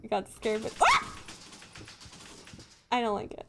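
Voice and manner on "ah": short, high, loud shriek; real yelp of fright; startled scream. Real, not acted. Streamer jumping at a scare, startled scream. Startled while playing horror game.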